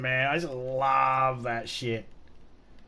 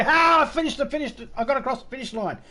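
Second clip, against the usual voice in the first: much higher mock child voice, fast and boastful; hammed-up bragging child; gloating kid; 11 semitones higher, 3 dB louder than usual. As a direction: crow about winning like a smug kid. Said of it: "and then they go".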